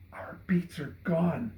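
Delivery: breathless, stunned whisper; gulped whisper. Acted. Boy gulping out the bad news to Seth in a whisper. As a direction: gulp the words out in shock. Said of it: "he gulped".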